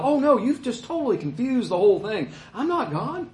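lightly acted, high-pitched flustered denial; mock balking. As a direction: balk in mock dismay, pitch climbing at the end. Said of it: "Jesus doesn't balk and go".

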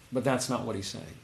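usual speaking voice

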